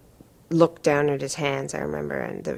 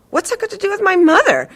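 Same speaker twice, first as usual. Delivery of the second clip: much higher, louder, fast outrage; patient shouting back at her therapist, indignant; 9 dB louder, faster than usual. Retold or imitated. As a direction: fire it back at him, outraged. Quarrel with the therapist.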